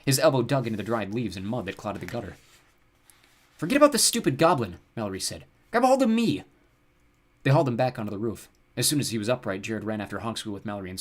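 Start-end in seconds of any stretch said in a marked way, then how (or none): 3.6-4.8 raised, higher exasperation; cross, louder voice; impatient order. A narrator's reading. Mallory impatient during rescue. 5.7-6.4 high, loud rescue command; strained, raised order; urgent call. A narrator's reading. Mallory urges brother during rescue.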